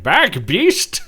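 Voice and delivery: high, loud battle cry; heroic shout. Retold or imitated. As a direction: bark it at the beast. Hero warns off monster.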